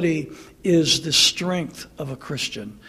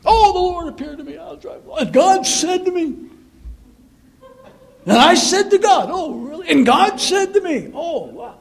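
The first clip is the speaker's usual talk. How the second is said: higher, louder breathless gushing; mock TV preacher; 8 semitones higher, 3 dB louder than usual. Mocking a TV preacher's endless revelations, hammed-up. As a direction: lampoon the vision-bragging preacher. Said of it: "they'll talk about how the Lord appeared".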